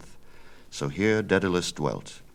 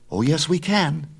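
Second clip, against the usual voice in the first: put-on higher, bold young son; eager young Icarus; 11 semitones higher, 6 dB louder than usual. Son argues with his father, higher and bold. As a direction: push back, higher and bold.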